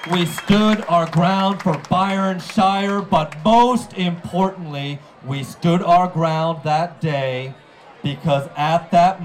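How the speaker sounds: loud, slow rallying oratory; rousing speech. Acted. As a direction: build to a stirring climax.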